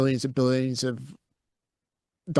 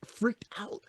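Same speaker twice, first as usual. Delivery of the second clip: ordinary delivery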